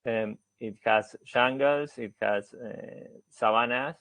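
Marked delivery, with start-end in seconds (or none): none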